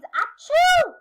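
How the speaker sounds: high, loud big sneeze; giraffe sneeze. Acted. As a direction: blast out a huge sneeze. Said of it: "she let out a sneeze".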